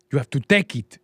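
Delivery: put-on African accent, punched out; mock African accent. Host quoting Blood Diamond, mock African accent. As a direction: quote the film in a hammed-up African accent. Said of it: "can do an accent".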